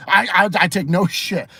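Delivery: usual speaking voice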